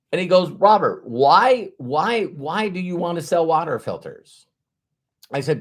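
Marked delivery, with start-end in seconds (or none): 0.5-4.5 retold insistent challenge. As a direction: press him for a reason, imitating an insistent mentor. Retold challenge from his mentor.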